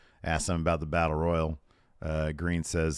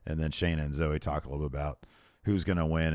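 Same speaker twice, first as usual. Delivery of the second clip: normal talking voice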